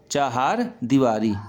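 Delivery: चाहार as chaa-haar, both a's long; both vowels long. Shown as a demonstration. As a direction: draw out both syllables of चाहार. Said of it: "ये शुद्ध शब्द है".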